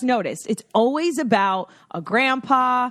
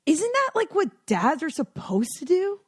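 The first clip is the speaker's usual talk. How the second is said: high, mock-baffled question; incredulous; 7 semitones higher than usual. To friends praising dads, high baffled disbelief. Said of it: "And I'm like".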